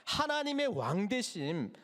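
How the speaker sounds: usual speaking voice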